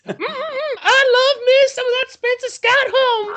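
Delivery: very high, sassy mock voice; hammed-up sassy older lady; high-pitched mock sassy lady.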